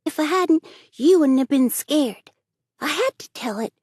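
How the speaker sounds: put-on high, loud little boy, pleased with himself; a cheeky boy grinning at his prank; boy's voice. Davy's childish voice.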